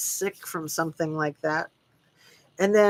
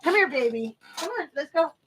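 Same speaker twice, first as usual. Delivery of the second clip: coaxing the dog; 9 semitones higher, faster than usual. Real, not acted. Streamer calls her dog to get it out, high coaxing. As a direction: coax a puppy over in a high voice.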